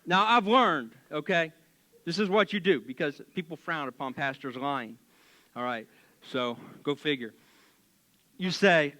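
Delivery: ordinary talk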